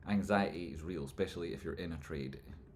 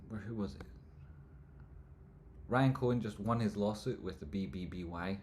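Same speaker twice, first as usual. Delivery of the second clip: usual speaking voice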